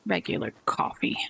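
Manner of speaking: muttered under the breath, unvoiced; whisper. Real, not acted. Barista muttering the order to himself in a whisper. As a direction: whisper it to yourself, grumbling.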